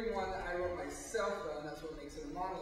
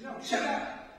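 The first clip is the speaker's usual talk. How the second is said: sudden loud snap, higher pitched; agitated outburst; 10 semitones higher, 10 dB louder, slower than usual. Acted. Narrator fights the voice in his mind, agitated shout. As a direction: shut the voice down sharply.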